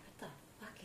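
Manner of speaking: hushed, breathy whisper; a groggy, hushed whisper in the dark; retold whisper. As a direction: whisper it like someone is outside the door. Woken by knocking, half asleep.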